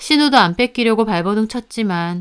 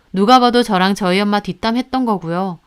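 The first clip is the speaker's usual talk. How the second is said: ordinary talk, nothing marked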